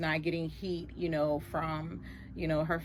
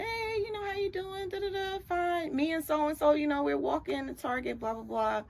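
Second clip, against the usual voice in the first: mother on the phone; 9 semitones higher than usual. Retold or imitated. Girlfriend's mother voiced on speakerphone.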